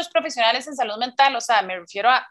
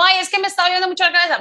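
higher, louder and fast, a hammed-up whine about a headache; whiny complaining friend; 7 semitones higher, 5 dB louder, much faster than usual. Imitating a friend who complains of a headache, fast and whiny. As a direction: rush out the complaint like a fussy patient.

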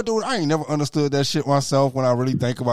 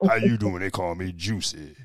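deep-voiced man; 5 semitones lower, 3 dB quieter than usual. Parodying a man called Juicy, low mock-sexy voice. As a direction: drop low and ease into a silly introduction. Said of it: "three octaves lower than mine".

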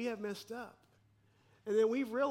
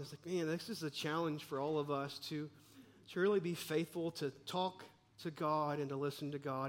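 ordinary delivery

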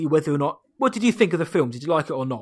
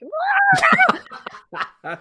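high shrieking laugh; loud laugh; 4 dB louder than usual. Real, not acted. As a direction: burst out laughing loudly. Big laugh at joke.

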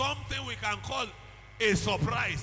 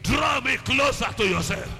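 louder, surging cries to the Father then tongues; loud fervent prayer; 6 dB louder than usual. Acted. Pastor leading the church to cry for God's attention, shouting. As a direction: plead and build into full tongues.

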